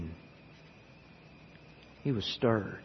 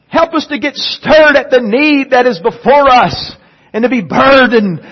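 full-force preaching; 11 semitones higher, 13 dB louder than usual. Acted. Pastor pleads with the church about sinners, full-force preaching. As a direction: thunder it from the pulpit, stretching each phrase.

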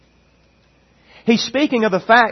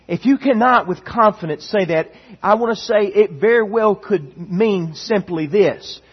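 normal talking voice

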